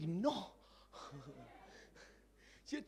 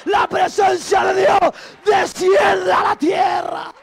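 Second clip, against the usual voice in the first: loud, ringing sermon voice, higher and louder; preaching at full force; 7 semitones higher, 14 dB louder, slower than usual. Acted. Preacher urging the congregation to praise God fully, shouting. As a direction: preach it out to the whole church.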